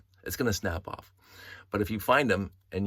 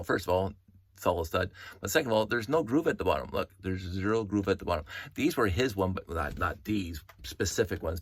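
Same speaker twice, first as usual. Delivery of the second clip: normal talking voice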